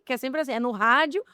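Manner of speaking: ordinary talk, nothing marked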